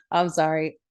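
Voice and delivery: normal talking voice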